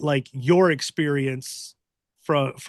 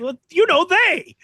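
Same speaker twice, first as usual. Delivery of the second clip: high, loud comic insistence; squeaky hammed-up insistence; mock exasperation; 6 dB louder than usual. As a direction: voice a cartoonish protest, pitched way up. Comic argument over who they are.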